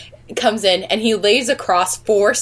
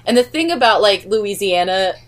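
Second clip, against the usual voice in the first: ordinary talk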